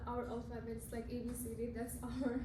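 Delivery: ordinary talk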